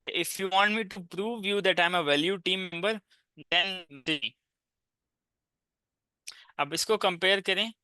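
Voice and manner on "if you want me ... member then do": slow reading aloud. Shown as a demonstration. Reads proposal line aloud.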